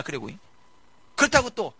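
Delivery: ordinary delivery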